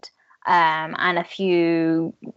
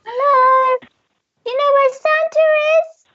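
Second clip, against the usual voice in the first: timid elf voice; 4 dB louder than usual. Acted.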